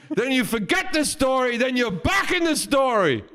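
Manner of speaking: high, exclaiming movie-star impression; hammed-up, excitable old star; Al Pacino. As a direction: gush compliments high and emphatic. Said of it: "chatted at that party to Al Pacino".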